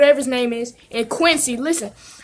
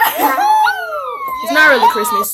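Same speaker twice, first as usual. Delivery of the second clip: much higher, slow squeaky mock voice; squeaky correction; much slower than usual. Vlogger answers the clip in a slow mock squeak. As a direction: squeak it out, dragging each word.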